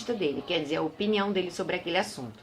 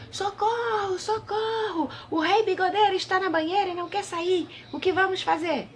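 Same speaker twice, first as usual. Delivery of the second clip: agudo e alto, grito de socorro desesperado; pajem gritando; 12 semitones higher than usual. A narrator's reading. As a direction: grite por socorro bem agudo, como um pajem aflito. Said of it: "o pajem gritou". (pajem aflito gritando socorro)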